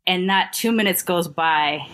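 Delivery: ordinary talk, nothing marked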